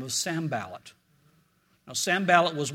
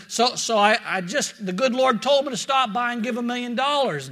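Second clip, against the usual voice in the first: a hint of a Texan drawl, a bit louder; lightly voiced Texan cowboy asking for the pastor; 6 semitones higher, 4 dB louder than usual. Preacher doing a tall Texan at the church office, light Texan drawl. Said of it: "but, but he says".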